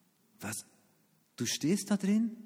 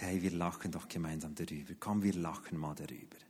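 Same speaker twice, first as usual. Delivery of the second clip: much softer, lower soothing wife; gentle wife; 11 semitones lower, 10 dB quieter than usual. Retold or imitated. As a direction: coax him to laugh it off. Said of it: "meine Frau und sagt".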